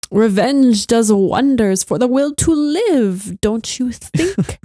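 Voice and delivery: slow, menacing villain purr; Grand Inquisitor ringtone. Retold or imitated. Fan imagining her ringtone as the Inquisitor, slow menace. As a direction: drawl the line like a smug Inquisitor. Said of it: "he just goes".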